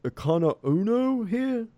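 high, loud, drawn-out call; yelling out. Acted. Captain calls out to the village for the elder, yelling out. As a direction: call out slowly over a crowd, voice climbing at the end. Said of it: "he yells out".

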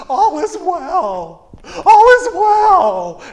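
frantic, high, slow shouting over chaos; panicked Kevin Bacon. Retold or imitated. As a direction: yell it high and drawn out, desperately insisting all is fine. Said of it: "there's a very young Kevin Bacon".

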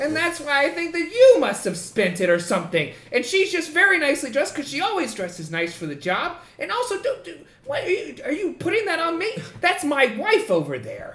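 a boss on the back foot. Acted. Boss defending his wife, high indignant sputter.

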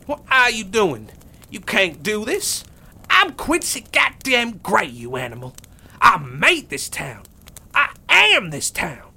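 high, loud, cracking outrage; desperate tyrant. Acted. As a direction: rage and beg as he crawls away. Quincy cornered, crawling away.

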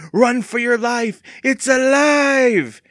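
mock panic, high and stretched out; playful scream. A narrator's reading. Evan pretending to flee the monster blood, playful scream. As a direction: wail it loud and slow like a monster-movie victim. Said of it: "Evan screamed playfully".

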